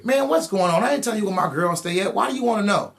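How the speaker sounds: high, indignant refusal; defensive. Retold or imitated. Refusing the cops, high and indignant. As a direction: push back, not telling them anything. Defying the cops' question.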